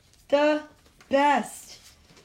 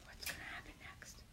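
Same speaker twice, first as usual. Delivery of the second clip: whisper; 27 dB quieter than usual. Real, not acted.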